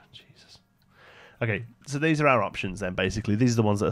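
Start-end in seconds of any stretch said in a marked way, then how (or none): 0.1-0.6 under-breath whisper of frustration. Real, not acted. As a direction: whisper it to yourself, exasperated. Muttered exasperated aside.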